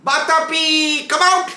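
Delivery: high, loud call to his brother; shouting out. Retold or imitated. Atapi calls his brother out of the sage's belly, shouting. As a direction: yell him out, voice way up. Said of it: "he shouts outside".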